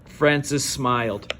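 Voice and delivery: plain reading voice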